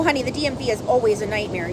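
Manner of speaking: ordinary delivery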